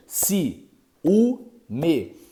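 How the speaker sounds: ciúme split into Si, u, me; syllable split. Shown as a demonstration. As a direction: count the syllables out aloud. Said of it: "em quantas sílabas?". ("ciúme" separado em sílabas: si-u-me)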